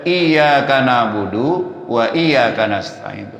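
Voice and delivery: slow, firm doubled y in "Iyyaka"; "Iyyaka" drawn out with a strong double y; correct recitation. Shown as a demonstration. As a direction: lean hard on the y of "Iyyaka". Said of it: "Tapi kalau kita baca".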